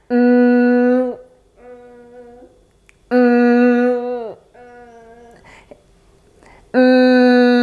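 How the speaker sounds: Mm hummed with nose held shut; pinched nose. Shown as a demonstration. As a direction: hum Mm while pinching the nose. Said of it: "and hold your nose like this".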